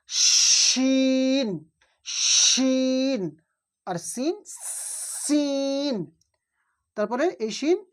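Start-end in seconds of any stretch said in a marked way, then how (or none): 0.1-3.2 শিন with a full sh and very long vowel; hushing শিন. Shown as a demonstration. Teacher contrasting it with Seen, hushing sh in শিন. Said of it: "শিনের মধ্যে সা সা আওয়াজ হবে". 5.2-5.9 সিন with a long whistled s and held vowel; whistling সিন. Shown as a demonstration. Teacher contrasting it with Sheen, whistling s in সিন. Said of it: "সিটির মতো আওয়াজটা একটু বেশি হবে".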